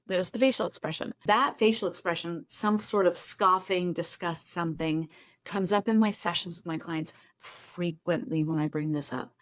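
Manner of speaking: normal talking voice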